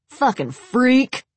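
high, slow angry snap; snapped. Acted. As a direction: bite it out, disgusted. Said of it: "Jimmy snapped".

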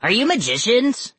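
high, pointed question; insistent, incredulous boy; demanding. A narrator's reading. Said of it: "Carter demanded".

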